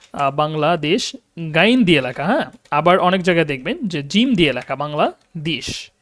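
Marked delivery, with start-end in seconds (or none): none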